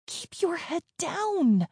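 high, slow, contained cry; urgent warning. A narrator's reading. Geeta pulling the child down behind the bush, urgent warning.

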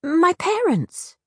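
high, slow, anxious plea; spluttering. A narrator's reading. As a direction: stammer it out, worried. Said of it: "Lena spluttered".

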